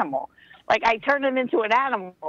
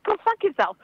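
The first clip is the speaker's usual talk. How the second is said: indignant; 10 semitones higher than usual. Retold or imitated. Retold retort to being called bitch.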